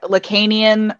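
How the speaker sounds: Lacanian with a long "kay" in the middle; American pronunciation. Shown as a demonstration. Host showing how Americans say it, Lacanian as luh-KAY-nee-un. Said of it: "that's how we say it".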